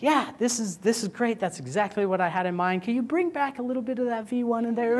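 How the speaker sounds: please them, then ask to undo; mock client. Client loving v3 but wanting v1 back, high mock voice.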